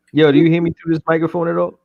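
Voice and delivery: ordinary talk, nothing marked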